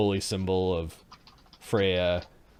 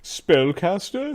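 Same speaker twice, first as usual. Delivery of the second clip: drawn-out musing; 8 semitones higher, 5 dB louder, much slower than usual. Acted. Master appraising captives.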